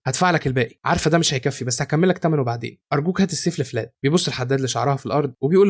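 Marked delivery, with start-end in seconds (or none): none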